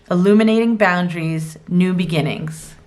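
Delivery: ordinary delivery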